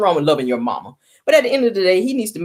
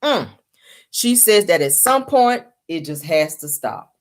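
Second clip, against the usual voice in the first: ordinary talk, nothing marked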